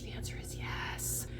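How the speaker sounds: whispered teasing aside; whispered bad news for non-French speakers. Real, not acted. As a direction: drop to a sly whisper.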